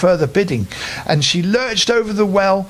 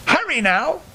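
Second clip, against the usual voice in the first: witch voice; 10 semitones higher than usual. A narrator's reading. Witch hurries the boy.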